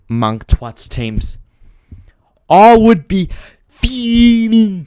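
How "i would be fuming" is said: high, loud, slow mock rage; hammed-up fury; fuming. Shown as a demonstration. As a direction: stretch it out like you're boiling over. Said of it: "I would be fuming if I supported".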